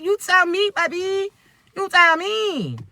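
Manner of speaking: high, teasing sing-song, slowing at the end; flirty tease.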